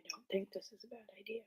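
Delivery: quick faint whisper; barely audible whisper. Real, not acted. Keeps whispering in the room.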